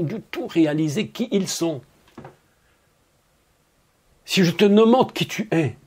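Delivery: normal talking voice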